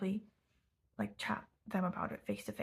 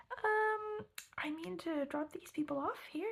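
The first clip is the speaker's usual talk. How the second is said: put-on high-pitched, hesitant young driver; young cab driver; 9 semitones higher, slower than usual. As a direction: imitate an unsure young cabbie, pitched way up. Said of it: "I think quite young".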